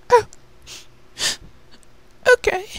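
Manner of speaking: small resigned little sister. Acted. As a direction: give in quietly, defeated.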